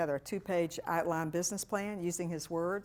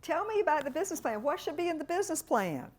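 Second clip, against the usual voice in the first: sweet curious asking; 10 semitones higher, 4 dB louder than usual. Retold or imitated. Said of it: "And I said".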